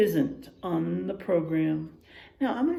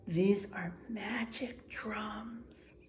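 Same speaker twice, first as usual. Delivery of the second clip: slow, hushed magic whisper; secret whisper; 11 dB quieter, much slower than usual. A narrator's reading. Old wildebeest revealing the magic drums, slow whisper. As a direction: breathe it like a secret. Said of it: "she says".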